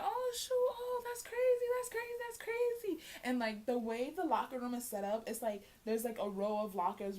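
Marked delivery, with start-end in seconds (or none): 0.0-3.0 high, gasping that's crazy; shocked classmates. Retold or imitated. Classmates hearing the news, high shocked voices. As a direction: react like stunned gossiping girls. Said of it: "everybody was like".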